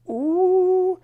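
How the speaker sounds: high, loud, drawn-out ooh; shocked ooh. Real, not acted. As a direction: gasp at the drama. Reacting to Heather's callout.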